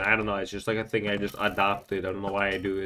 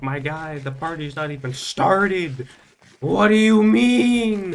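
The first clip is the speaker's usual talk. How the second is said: builds from higher disbelief to a slow, much louder, much higher drawn-out cry; incredulous outburst; 8 semitones higher, 8 dB louder, slower than usual. Retold or imitated. Telling his friend the party hasn't started, incredulous. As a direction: stretch the last question out in shock.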